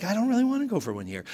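fast, high whine; whiny reluctance. Retold or imitated. Son whines back at dad about going, fast and high. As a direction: rush it out petulantly.

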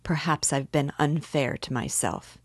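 usual speaking voice

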